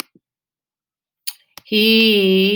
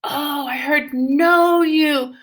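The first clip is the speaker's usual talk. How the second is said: mock realization; 7 semitones higher, 3 dB louder, much slower than usual. Shown as a demonstration. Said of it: "teacher feigns surprise at mistake".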